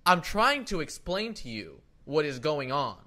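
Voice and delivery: loud, slow, emphatic explaining; retold exasperated tone. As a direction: spell it out slowly and loudly for a clueless clerk. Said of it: "use that tone".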